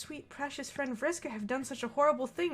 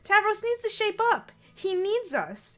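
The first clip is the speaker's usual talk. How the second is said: Vriska voice; 8 semitones higher, 5 dB louder than usual. Acted. Voicing Vriska.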